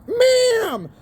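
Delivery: yelling ma'am back at the Walmart worker; mock yell back. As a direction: fire it back at the clerk. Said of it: "I'm like".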